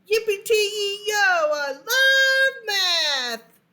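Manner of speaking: loud, high, slow sung-out cheer; loud joyful exclaim. Acted. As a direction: belt it out with pure delight. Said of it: "every time he exclaimed".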